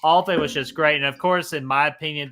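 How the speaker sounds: normal talking voice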